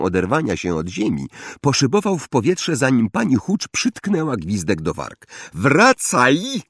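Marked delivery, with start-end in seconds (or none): 5.5-6.6 understated higher-pitched teacher's shout; mild shout. A narrator's reading. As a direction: call a pupil back, pitched up. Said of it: "krzyknęła".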